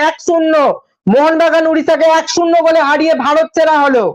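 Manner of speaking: normal talking voice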